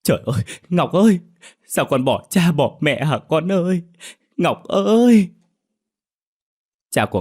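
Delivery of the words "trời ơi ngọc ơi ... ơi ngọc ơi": high, loud, fast crying wail over a dead daughter; mother keening, voice breaking and peaking on the last cry; wailing in grief. A narrator's reading. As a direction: howl her name through sobs. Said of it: "chạy ra ngoài khóc nói".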